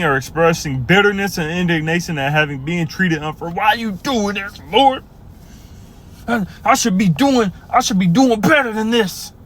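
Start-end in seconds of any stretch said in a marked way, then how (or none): 3.5-9.3 a sulking believer pleading with the Lord; whining complainer. Acted. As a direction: whimper it like a sulking child. Acting resentful believer.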